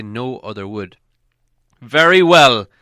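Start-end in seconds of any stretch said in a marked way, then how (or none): 1.8-2.7 much louder, higher, final; the elf king ending the questioning, much louder; commanding king. A narrator's reading. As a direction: pronounce it like a royal decree. King's cold verdict.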